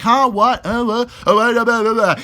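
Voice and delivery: imitated incoherent babbling; mock gibberish. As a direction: babble mockingly, as if making no sense. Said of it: "He was literally talking in, like, fucking gibberish".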